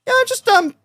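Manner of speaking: high, hesitant put-on driver voice; mock sheepish driver; imitating driver. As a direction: stall like a driver caught by enforcement. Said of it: "He was like".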